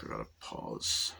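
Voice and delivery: low, soft muttered aside off mic; quiet aside. Real, not acted. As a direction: drop to a hushed whisper. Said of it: "one sec".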